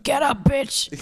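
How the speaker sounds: high, pushy nagging gadget voice; bossy fitness tracker voice. Retold or imitated. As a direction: nag like an annoying Fitbit. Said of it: "He's like".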